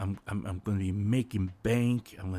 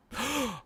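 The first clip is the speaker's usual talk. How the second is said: mock shocked gasp; 12 semitones higher, 6 dB quieter than usual. Imagining a forbidden duet.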